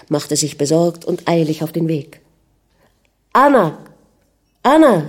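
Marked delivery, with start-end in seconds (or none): 3.3-5.1 loud, high call of a name, falling at the end. A narrator's reading. Prince searching for his wife Anna, calling out. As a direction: call out to find someone. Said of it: "rief er".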